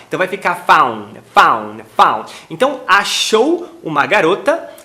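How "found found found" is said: found ending on N, the D deleted; dropped D. Shown as a demonstration. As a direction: cut the final D of found. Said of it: "apagamento desse D final".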